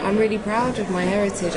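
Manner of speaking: normal talking voice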